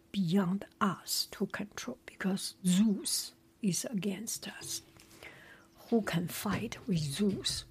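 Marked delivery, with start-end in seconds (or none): none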